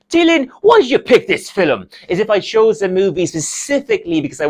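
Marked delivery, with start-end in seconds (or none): 0.1-1.9 high, loud mock-dad complaint; imitated dad's protest. Dad complaining to son about the film choice, indignant. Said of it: "he'll go".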